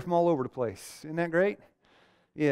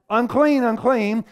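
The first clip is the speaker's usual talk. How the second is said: higher, louder cry of the outcast; mock yell of unclean; 9 semitones higher, 7 dB louder, slower than usual. Leper warning the camp, a louder higher cry. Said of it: "I yell what?".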